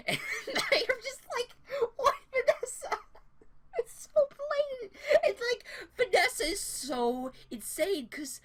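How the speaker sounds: words squeezed out through high laughter; can barely get it out for laughing; real giggling fit over a blatant lie; laughing hard. Real, not acted. Speaker cracking up while recounting.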